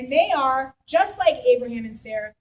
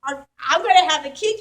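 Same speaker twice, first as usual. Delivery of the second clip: incredulous laughing; 4 semitones higher, 5 dB louder than usual. Retold or imitated. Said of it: "falls down double over in laughter".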